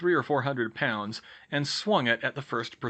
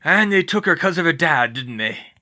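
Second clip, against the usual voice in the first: loud rustic big-man voice; gruff, upset gamekeeper; Hagrid, troubled; 5 semitones higher, 9 dB louder than usual. Acted. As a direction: ask heavily, blaming himself.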